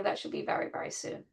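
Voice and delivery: breathy whisper, trailing away; whispered hope. Real, not acted. Teacher hoping for the hostages' return, whispering. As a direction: breathe it out like a quiet prayer.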